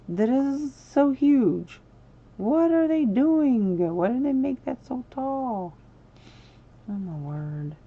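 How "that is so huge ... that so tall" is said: high, loud, slow disbelief; slow, high, loud astonishment; shocked. Real, not acted. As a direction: gasp at how huge it is. Shocked at resized fountain.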